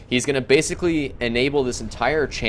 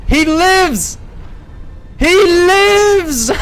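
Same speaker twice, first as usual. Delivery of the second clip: shrieking, far higher and much louder; ecstatic screaming; 7 dB louder, slower than usual. Real, not acted. Caster to viewers as the commander survives, ecstatic screaming. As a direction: lose it completely at the miracle save.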